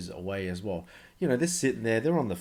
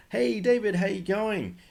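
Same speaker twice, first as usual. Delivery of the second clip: much higher and louder, beaming cheerful greeting; very positive tone; 11 semitones higher, 4 dB louder than usual. Shown as a demonstration. Cold caller greeting a busy CEO with a very upbeat, high, bright hello. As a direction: sound overjoyed to reach him on the phone. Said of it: "the most positive tone of voice".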